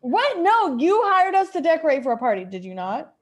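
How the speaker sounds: ordinary delivery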